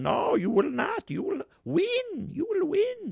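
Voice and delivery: very high, slow insistent command; high commanding woman. Retold or imitated. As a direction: order him never to give up. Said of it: "she told him".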